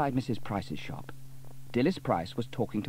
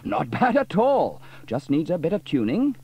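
pleased Sam; 7 semitones higher, 7 dB louder than usual. A narrator's reading.

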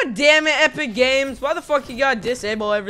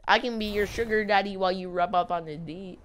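usual speaking voice

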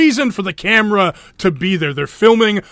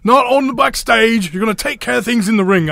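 hammed-up British accent, pitched higher and faster; a horrible fake British impression of Regal, higher; lightly faked British accent, higher and quicker; bad British accent; 5 semitones higher than usual. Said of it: "as I do a horrible, uh, accent there".

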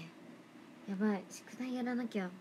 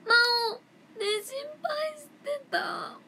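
much higher, slow, near tears; crying worried voice; 12 semitones higher, 7 dB louder, slower than usual. Pretend. Imitating a worried friend, a high slow mock cry. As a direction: wail it out in mock distress. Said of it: "モノマネをしましょう".

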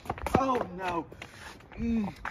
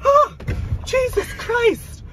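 much higher, louder startled shriek; startled scream; 7 dB louder, slower than usual. Acted. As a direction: jump out of your skin. Startled by something.

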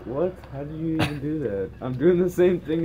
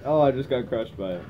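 normal talking voice

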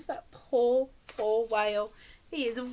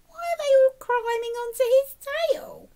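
high, louder questioning; puzzled; 13 semitones higher, 5 dB louder than usual. Acted. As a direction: sound astonished for the children. Mock-puzzled question to children.

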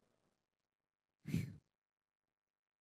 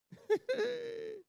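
high laugh. Real, not acted. Preacher laughs at the worrier.

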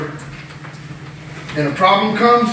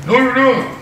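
mocking retort; 6 semitones higher, slower than usual. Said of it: "He's like".

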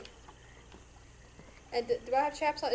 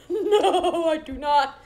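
laughing refusal; 3 semitones higher, 9 dB louder, slower than usual. Real, not acted.

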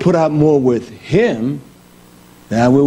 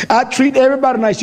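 mock self-praise; 8 semitones higher, faster than usual. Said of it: "We cry like".

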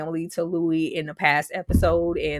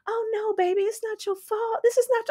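mock tender cousin. Imitating the cousin comforting her, high cooing mimic. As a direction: coo like a comforting relative.

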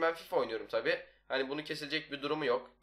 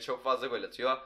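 normal talking voice

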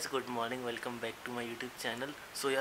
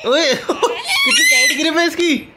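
laughing surprise; 23 dB louder, much slower than usual. Real, not acted. Uncle teasing toddler grabbing camera.

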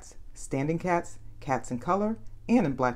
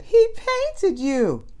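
high, slow, sing-song tease; giggly teasing; 8 dB louder, much slower than usual. A narrator's reading. Annie teases the paint-covered detective, high sing-song. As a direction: draw it out, amused.

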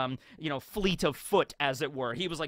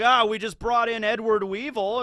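court clerk; 7 semitones higher, 6 dB louder than usual. Acted. Clerk announces prisoner.

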